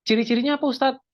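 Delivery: playing a curious student raising a question; teacher voicing a student's question; mock student question. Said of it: "teacher voices a student question".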